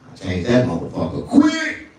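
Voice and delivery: ordinary talk, nothing marked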